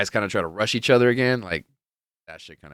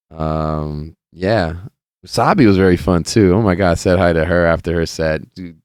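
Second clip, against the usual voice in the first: ordinary delivery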